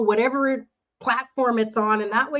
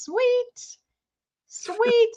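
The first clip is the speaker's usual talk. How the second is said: excited; 12 semitones higher than usual. Real, not acted. Winner picked, cheering.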